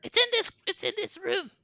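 very high squeaky crone; excited hag. Acted. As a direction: squeal it like a giddy old hag.

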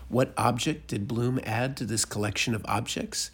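ordinary delivery, nothing marked

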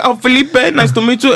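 high-pitched mock Dominican greeting; Dominican accent. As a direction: imitate a Dominican man introducing himself. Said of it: "really thick Dominican accent".

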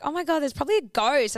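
panicked retelling about a ghost; excited. Retold or imitated. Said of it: "I was like".

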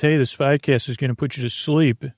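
ordinary delivery

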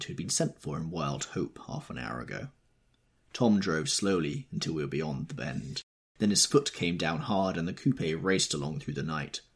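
ordinary delivery

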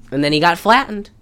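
normal talking voice

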